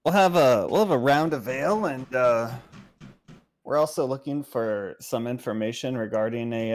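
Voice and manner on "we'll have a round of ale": ordinary talk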